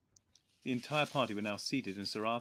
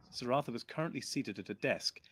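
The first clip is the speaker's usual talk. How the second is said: plain reading voice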